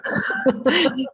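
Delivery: high-pitched burst of laughter; laughing. Real, not acted. Guest caught oversharing on the podcast, amused laughter.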